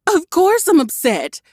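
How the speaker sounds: high, loud retort; angry retort. A narrator's reading. Cherry snaps at Dante for leaving, high and loud. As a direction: fire it back. Came out in a hiss.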